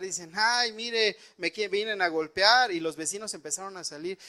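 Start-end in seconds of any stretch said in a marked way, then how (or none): 0.3-2.7 high, loud alarmed cry for help; mock panicked yell at the door; neighbors yelling. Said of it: "empezó a gritar".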